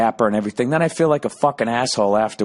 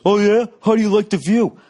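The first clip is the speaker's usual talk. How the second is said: high, louder taunting comeback; mock confrontational; 12 semitones higher, 5 dB louder than usual. Man challenging the fan behind him, mock confrontational. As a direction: imitate a guy squaring up, pitched up.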